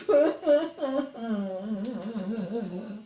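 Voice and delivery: a light real chuckle; soft laugh. Real, not acted. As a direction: laugh quietly mid-song. Laughing at the crying verse.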